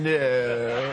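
a hint of the wife objecting with a laugh; mock wife's denial from the front row; wife's protest. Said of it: "My wife's on the front row saying".

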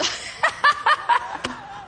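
high, breath-driven staccato laugh; demonstrated cackle. Shown as a demonstration. Preacher assaulting the stronghold, demonstrated cackle. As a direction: attack with a burst of cackling.

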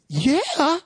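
high, drawn-out "Yeah"; imitating a surprised yes; retold amazed yeah. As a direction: answer high as if just realizing it. Retold reply about his healing.

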